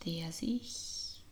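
ordinary talk

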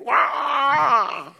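long, very high, loud war yell; William Wallace battle cry. Pretend. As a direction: charge in with a drawn-out battle roar. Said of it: "William Wallace".

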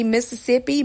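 ordinary talk

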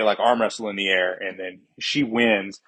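ordinary delivery

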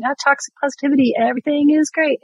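high, sing-out fake cheer; mock positivity. Guest parodying people who preach toxic positivity, mock-cheery. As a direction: parody someone relentlessly upbeat. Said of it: "people like us are going".

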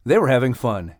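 ordinary delivery, nothing marked

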